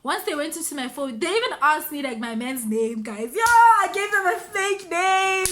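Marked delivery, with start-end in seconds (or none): 3.3-5.5 high, loud, drawn-out exclamation; amazed exclamation. Real, not acted. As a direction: exclaim to your listeners in disbelief, stretching it. Exclaiming over her own fake name.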